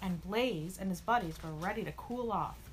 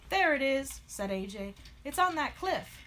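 louder, higher exclamation; excited discovery; 6 semitones higher, 5 dB louder than usual. Acted. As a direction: call out a find to friends. Character spots the missing mast.

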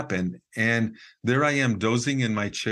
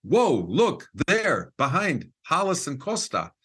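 excited call; 6 semitones higher, slower than usual. Retold or imitated. Man at the camera calling across the dark circle, excited. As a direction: call out to the group in surprise. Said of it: "his voice in our direction said".

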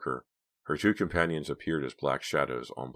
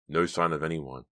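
ordinary delivery

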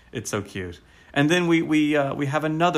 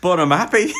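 higher, louder mock West Country yokel, cheerful; imitated West Country farmhand; West Country bumpkin; 6 semitones higher, 7 dB louder than usual. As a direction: beam it out like a simple country lad. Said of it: "So he's kinda talking like this".

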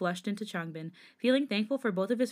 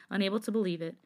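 ordinary delivery

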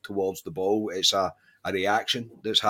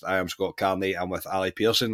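ordinary talk, nothing marked

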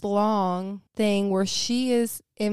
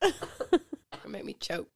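choked with laughter; laughing; slower than usual. Real, not acted. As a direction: laugh through the words. Laughing through story.

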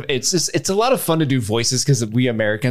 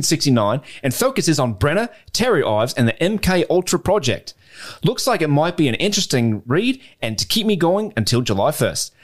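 put-on Australian accent; 2 semitones higher than usual. Podcast host reading a listener's email from Perth, Australian accent. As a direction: read it out in your best Australian accent. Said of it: "do this in my best Australian accent".

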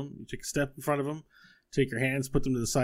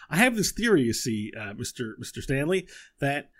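high, eager inventor voice; high professorial little voice; Buttons theorizing; 5 semitones higher, 3 dB louder than usual. Acted. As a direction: explain the theory excitedly. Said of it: "And he says".

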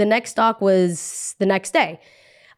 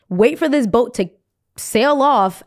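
usual speaking voice

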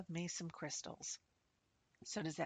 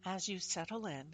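slow, soft meditation voice; guided meditation; 5 dB quieter, slower than usual. Acted. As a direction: guide them into calm, slowly.

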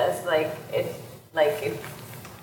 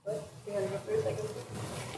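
hushed aside; 2 semitones higher, 12 dB quieter than usual. Real, not acted. Aside to co-panelist before answering.

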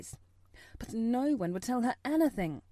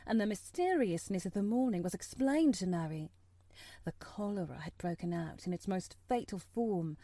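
ordinary delivery, nothing marked

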